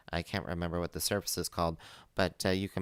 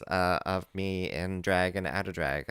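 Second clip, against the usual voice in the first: ordinary talk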